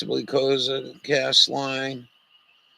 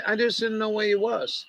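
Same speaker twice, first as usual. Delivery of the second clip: higher, gentle voice for the dog; talking to a dog; 5 semitones higher than usual. Real, not acted. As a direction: coax the dog kindly.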